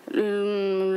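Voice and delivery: ordinary talk, nothing marked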